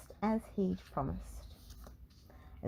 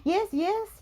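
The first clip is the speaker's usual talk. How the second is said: high, loud, hurried yes; a high, loud, hasty yes; 7 dB louder than usual. Acted. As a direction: rush to agree, alarmed. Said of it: "he said hurriedly".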